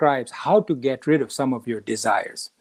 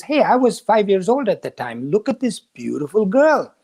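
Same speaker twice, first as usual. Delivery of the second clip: lightly voiced prince, a bit higher and delighted; a prince gushing over a pretty face, mildly acted; smitten young prince; 5 semitones higher than usual. Prince admires his own childhood portrait.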